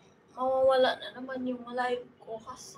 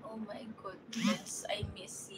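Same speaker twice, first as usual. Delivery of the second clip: slow whisper; hushed, fond whispered hello; whispered greeting; 11 dB quieter than usual. Real, not acted. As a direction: whisper it slowly to a missed friend. Greeting a returning viewer.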